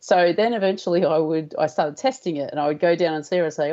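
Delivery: usual speaking voice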